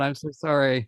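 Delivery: normal talking voice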